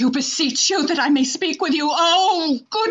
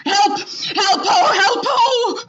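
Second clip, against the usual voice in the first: shouting for help; 6 semitones higher, slower than usual. Acted. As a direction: call out in alarm. Emilia calls for help.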